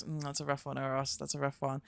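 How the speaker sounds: soft and low, commiserating; quiet sympathy. Real, not acted. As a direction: drop the voice, gently sympathetic. Sympathy for money woes.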